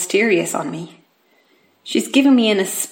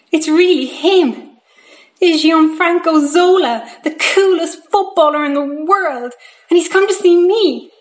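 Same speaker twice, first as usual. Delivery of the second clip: higher, awestruck excitement; thrilled; 6 semitones higher, 4 dB louder than usual. Acted. Boy realising his hero has come to see him, thrilled. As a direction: marvel at it, stressing each big word.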